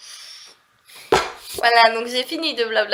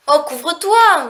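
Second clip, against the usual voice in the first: loud, high, drawn-out warning; acted scolding; 6 semitones higher, 6 dB louder, slower than usual. Parent warning a child to cover up, mock scolding. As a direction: call it out slowly, exasperated.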